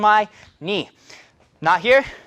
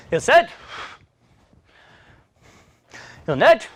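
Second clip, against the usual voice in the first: loud, punchy count of each rep in Korean; 한 번씩 힘차게 외치는 구령; counting calls; 5 semitones higher, 3 dB louder, much faster than usual. Real, not acted. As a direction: bark out every number to drive the set. Instructor counting out kicks.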